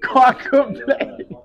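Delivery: laughing. Retold or imitated. Repeating the quote, laughing.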